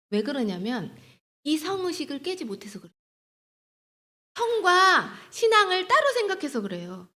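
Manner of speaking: ordinary talk, nothing marked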